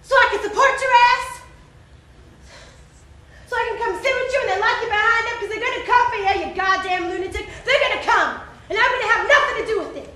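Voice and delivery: hollered mocking questions, pushed loud; derisive hollering. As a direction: holler it like you're laughing in his face. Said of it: "burst out laughing, hollering".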